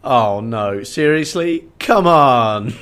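exaggerated groan of disbelief; exasperated. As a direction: throw up your hands in mock despair. Exasperated reaction retold.

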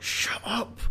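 sharp whisper, no voice at all; whispered telling-off to a coughing sister; hissed shut up. Retold or imitated. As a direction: hush her in a whisper. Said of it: "And then Tim's like".

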